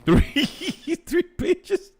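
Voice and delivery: high, slow, laughing disbelief; laughing surprise. Real, not acted. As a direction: gasp it out in amazed laughter. Astonished at comment length.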